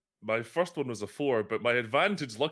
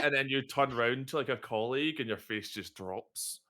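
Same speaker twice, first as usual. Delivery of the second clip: usual speaking voice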